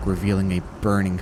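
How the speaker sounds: ordinary delivery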